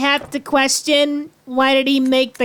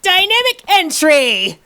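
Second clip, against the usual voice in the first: shouted catchphrase; 7 semitones higher, slower than usual. Pretend. Bursting into a room, calling out.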